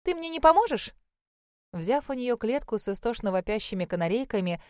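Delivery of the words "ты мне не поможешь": high, loud fussy lady voice; a bubbly fussing hostess; chirpy older lady. Acted. Mayor's wife asks, put-on voice.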